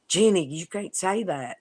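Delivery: a mock-scandalised telling-off to a little girl; shocked scolding. Scolding her little sister in a story.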